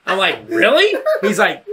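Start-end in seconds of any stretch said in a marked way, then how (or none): 0.5-1.0 high, loud surprised question; incredulous. Retold or imitated. Kid hearing an easy deal, incredulous. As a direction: pop it up in disbelief.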